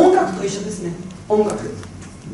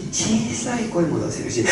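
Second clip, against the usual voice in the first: quiet voice demo; 8 dB quieter than usual. Shown as a demonstration. Speaker demonstrating a small voice to the audience, hushed. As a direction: shrink the voice on 小さい.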